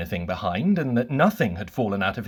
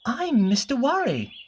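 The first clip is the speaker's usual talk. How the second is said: much higher, anxious fretting little voice; Mister Worry's voice; 11 semitones higher than usual. Acted. Mister Worry frets to Mister Bump in a high, anxious voice. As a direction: fret over him in a high, worried voice. Worry introduces himself to wizard.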